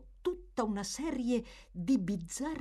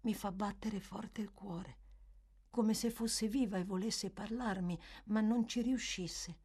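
put-on softer, hushed child's confession; hushed and awed; low voice; 3 semitones higher, 5 dB quieter than usual. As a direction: speak it low, half afraid of the portrait. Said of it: "soggiunse il bambino a voce bassa".

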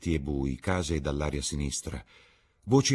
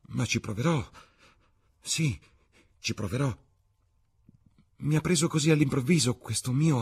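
put-on high-pitched, imploring young actress; pleading Sibyl; 6 semitones higher than usual. A narrator's reading. Sibyl at Dorian's feet begging him to stay, pleading. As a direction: plead in a higher voice, desperate to keep him.

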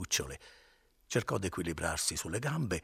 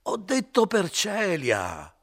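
higher, louder, slow insistent shout; shouted in his face; 13 semitones higher, 9 dB louder, much slower than usual. Acted. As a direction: shout him down, up close. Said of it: "gridò questo sul viso di Renzo".

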